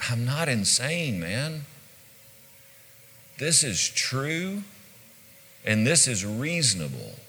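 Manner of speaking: soft, measured calm reply; calm counter. Acted. Paul counters Festus's attack, acted composed and calm. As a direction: answer the accusation quietly, unruffled. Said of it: "Paul just calmly counters".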